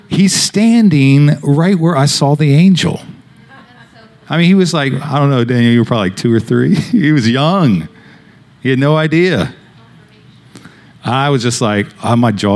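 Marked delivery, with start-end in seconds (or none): none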